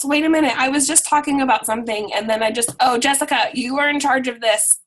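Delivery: ordinary talk, nothing marked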